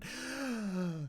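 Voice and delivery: high, theatrical gasp; hammed-up intake of breath; mock gasp. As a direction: gasp in fake shock. Mock shock in a list.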